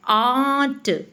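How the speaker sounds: आंट stretched very slowly with a long open aa; teacher stretching आंट slowly so kids hear the aa; drawn-out aant. Shown as a demonstration. Teacher to students, slowly stretching आंट to show the aa sound. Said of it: "आंट में आ की sound आ रही है".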